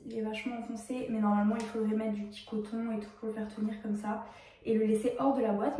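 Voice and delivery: ordinary talk, nothing marked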